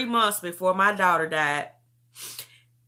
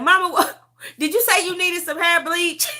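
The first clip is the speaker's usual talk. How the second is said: high-pitched mock question breaking into laughter; laughing; 10 semitones higher, 6 dB louder than usual. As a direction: imitate your daughter, cracking up as you ask. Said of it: "that's why I'm laughing".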